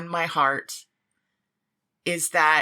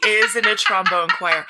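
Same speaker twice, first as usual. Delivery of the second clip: IS hit in a much higher, louder squeal; delighted squeal; 6 dB louder than usual. Real, not acted. Excited echo of trombone choir fact.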